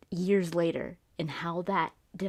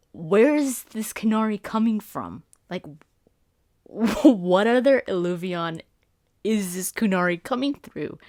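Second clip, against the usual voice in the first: excited; 2 semitones higher, 6 dB louder than usual. Retold or imitated. Amazed reaction to trailer.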